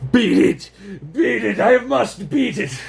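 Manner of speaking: high, loud, slow chant; determined shout. Acted. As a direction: shout it like a mission. Voicing a determined particle.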